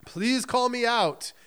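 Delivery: mock solemn pleading, taken slowly; slow, drawn-out plea. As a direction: draw it out like a slow-motion moment. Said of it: "like these were all slow moments".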